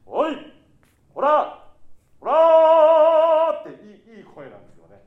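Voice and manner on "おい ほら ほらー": sudden high shouts, last one stretched long; angry voice. Shown as a demonstration. Director shows how a strong voice can sound angry, shouting. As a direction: bark out ほら like an angry scolding. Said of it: "怒ってるような声で".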